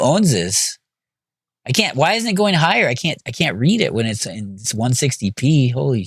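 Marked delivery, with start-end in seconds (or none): none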